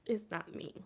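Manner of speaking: mumbled repeat of the joke; quiet trailing denial. Real, not acted. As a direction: let it fade out. Repeated denial, fading takes.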